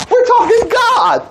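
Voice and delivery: high, slow, ringing shout; shouted retort. Real, not acted. Preacher shouting back at the doubter about God.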